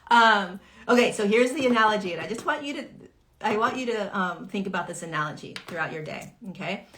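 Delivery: ordinary delivery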